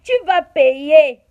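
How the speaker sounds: Tu vas payer stretched out slowly and loudly, every syllable hammered; slow, loud threat. Shown as a demonstration. Warning her rival she will pay, slow and loud. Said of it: "You go payer".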